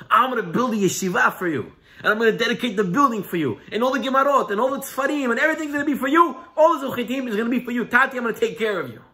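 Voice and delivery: high, effusive pledge piling up; eager promising. Acted. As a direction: gush promises to your father, pitch climbing. Son makes lavish promises to father.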